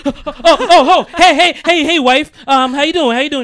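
high loud startled stammer; flustered nervous greeting. Retold or imitated. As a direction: play caught off guard, overeager. Husband flustered greeting wife.

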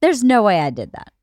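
usual speaking voice

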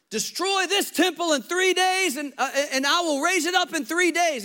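high, loud yell in Jesus' voice; Jesus yelling. Retold or imitated. Jesus yelling at the temple crowd after flipping tables. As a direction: shout it out across the temple. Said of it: "he yells out".

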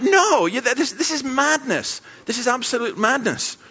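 high, exasperated protest; hammed-up dismay; appalled disbelief. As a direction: reject it as utterly absurd. Retold horrified reaction to story.

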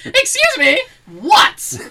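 high, loud mock-indignant challenge; hammed-up rude local, loud and high; mock outrage. Joking local snapping at approaching tourists, mock outrage.

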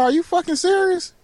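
mock outrage at the officer; shocked outburst. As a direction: blurt it out, caught off guard. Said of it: "I'm like".

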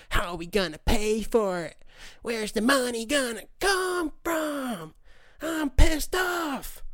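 high, slow, whiny mock voice; mocking a critic. Mocking a critic of health care in a whiny voice. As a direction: drag it out like a sneering skeptic. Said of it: "He's an".

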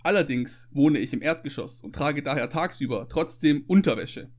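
usual speaking voice

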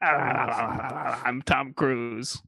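slow, drawn-out Tom Cruise voice; slow movie-star Tom Cruise voice; Tom Cruise impression. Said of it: "That sounded just like Tom Cruise".

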